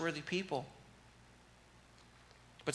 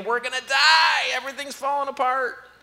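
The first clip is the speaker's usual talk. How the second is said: put-on high, loud panicked wail of a frightened kid; mock panic; 11 semitones higher, 12 dB louder than usual. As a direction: squeal it like a kid sure the world is ending. Kid panicking, mimicked by preacher.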